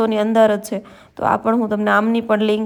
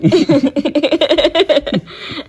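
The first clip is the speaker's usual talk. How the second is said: laugh; 6 semitones higher, 5 dB louder than usual. Real, not acted. Husband's grumpy reply amuses her.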